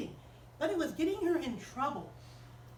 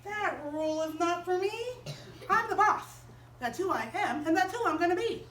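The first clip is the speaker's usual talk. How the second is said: put-on bossy little girl; 3 semitones higher than usual. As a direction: play the spoiled kid who runs things. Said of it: "Sally Fat thought".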